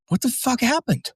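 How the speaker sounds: high-pitched imitation of a shocked buddy; shocked mock outcry. Goofy buddy spotting his injured friend, high-pitched mock shock. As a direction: squeal the question way up high, mimicking him.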